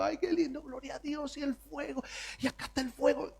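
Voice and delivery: high and hushed, swooning; rapturous awe. Retold or imitated. Ezequiel marvelling at the fire, high and hushed rapture. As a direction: gush softly over a heavenly vision. Ezequiel enraptured by open heavens.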